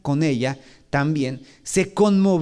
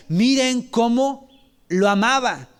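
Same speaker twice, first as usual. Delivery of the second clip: asombro conmovido; 5 semitones higher, slower than usual. Retold or imitated. La gente comenta asombrada.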